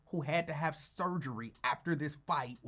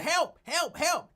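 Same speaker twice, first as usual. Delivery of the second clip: high, loud hammed-up help help help; mock screaming for help; 11 semitones higher, 6 dB louder, slower than usual. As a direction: squeal it out like a panicked man. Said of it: "Lincoln is screaming".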